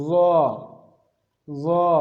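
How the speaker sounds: ظا said like ضاد without the d ending; letter demo. Shown as a demonstration. Teacher introducing the letter ظا. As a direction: sound out ظا. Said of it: "چوتھے حرف کی طرف آتے ہیں".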